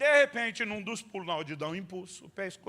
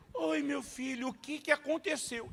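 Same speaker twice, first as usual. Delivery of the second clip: tender mother; 7 semitones higher, slower than usual. Retold or imitated. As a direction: coo it like a mom. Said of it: "ela olha pro meu filho e diz".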